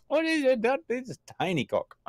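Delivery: very high mock-onlooker voice; put-on high voice, imitating the crowd. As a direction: squeak it like an astonished bystander. Mimicking the onlookers' reaction.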